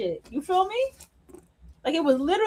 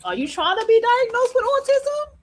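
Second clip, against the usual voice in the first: incredulous; 9 semitones higher than usual. Real, not acted. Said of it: "incredulous rhetorical question".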